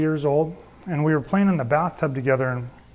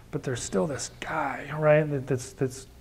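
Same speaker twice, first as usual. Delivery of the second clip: hushed whisper; 6 dB quieter, slower than usual. Real, not acted. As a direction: whisper it like a confession. Confessing inner hatred.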